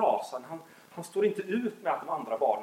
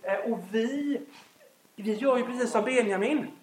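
normal talking voice

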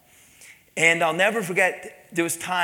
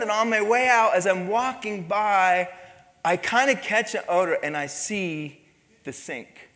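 usual speaking voice

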